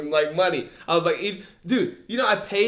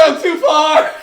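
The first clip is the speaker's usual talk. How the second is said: usual speaking voice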